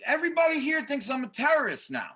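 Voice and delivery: high-pitched, indignant retelling; acted-out aggrieved outburst; exasperated protest. Retold protest at being escorted.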